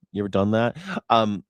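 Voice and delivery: ordinary delivery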